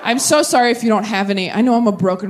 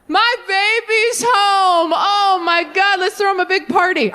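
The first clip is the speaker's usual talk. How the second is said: gushing dad; 9 semitones higher, slower than usual. Retold or imitated. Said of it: "And the dad goes".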